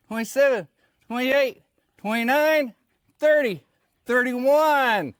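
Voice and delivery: strained loud counting mid push-up; doing push ups. Real, not acted. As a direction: count each rep out hard and high.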